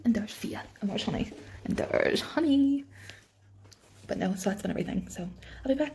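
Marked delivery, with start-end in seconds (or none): 1.6-2.8 higher, slow pet-talk croon; a sweet, drawn-out hello to the dog; cooing at the dog. Real, not acted. Repeats the dog's name, cooing.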